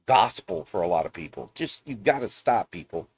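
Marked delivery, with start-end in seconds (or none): none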